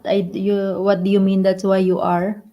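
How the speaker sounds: normal talking voice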